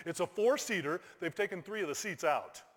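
normal talking voice

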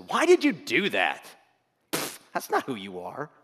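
loud, scornful, contemptuous; condemning enemy. Acted. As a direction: sneer it down at them. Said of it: "Hear the difference?".

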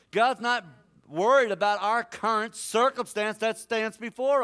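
loud, high preacher's roar; full-force preaching. Acted. Preacher telling the church God is unhindered, shouting. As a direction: hammer every line home.

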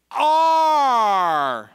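"Are" loud and long, sliding down; emphatic ARE. Shown as a demonstration. Preacher repeating the key word, emphatic "Are". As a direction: belt out "Are" and hold it.

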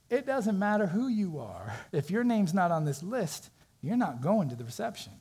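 a hint of a haughty doorman laying down the rule; stern maitre d'. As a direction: maitre d' turning away the singer, lightly voiced stern. Maitre d' refusing entry.

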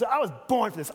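show-off line; boastful. Shown as a demonstration. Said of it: "that's not the tone Jesus used".

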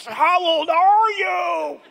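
high, slow laughing shout; mock disbelief. Young person mocking an old person, high slow shout. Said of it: "railed on those old people".

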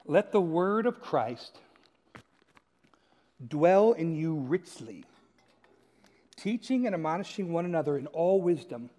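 slow, measured verse reading; scripture reading. Real, not acted. Preacher reading Colossians to the congregation, slow and solemn. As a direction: read it out slowly with weighty pauses. Reads a Bible verse aloud.

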